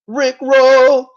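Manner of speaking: high, loud, gleeful shout; excited shout. Acted. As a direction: belt out the pun like a hype man. Repeats pun, then shouts it.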